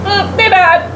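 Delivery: usual speaking voice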